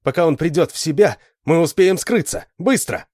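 high, loud, hurried urging; urgent shouting. A narrator's reading. Alan urges Lita to hide, shouting. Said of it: "закричал Алан Лите".

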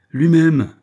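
loud, high snapping comeback; sharp retort. A narrator's reading. Orderly retorting for his captain, loud and high. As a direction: fire it back proudly. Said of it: "riposta Ben Zouf".

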